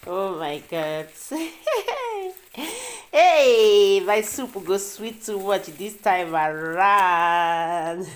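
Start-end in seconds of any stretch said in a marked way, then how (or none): 1.3-2.3 high amused giggle; a short bright laugh; giggling at the surprise. Real, not acted. Laughing at self-opening pack. 3.1-8.1 loud hey, then slow savoring; joyful cry, then slow gloat; delighted exclaim. Real, not acted.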